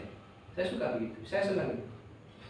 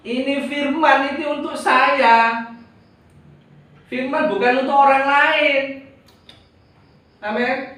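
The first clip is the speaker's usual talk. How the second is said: higher, louder, slow proclamation; raised preaching; 7 semitones higher, 6 dB louder than usual. Acted. Preacher proclaiming to the congregation that the word is for him, loud. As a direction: preach it out with conviction. Emphatic sermon climax.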